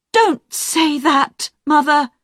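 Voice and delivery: high, slow, pleading cry; upset cry. Acted. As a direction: beg her mother to stop. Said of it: "Jackie cried".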